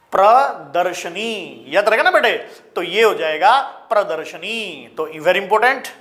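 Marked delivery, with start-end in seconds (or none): 0.1-1.6 slow dictation. Shown as a demonstration. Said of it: "इस तरह से लिखा जाएगा".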